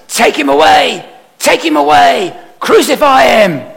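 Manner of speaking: high, loud mob shout; crowd shouting. Retold or imitated. The crowd shouts at Pilate for crucifixion, a loud high yell. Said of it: "But they shouted".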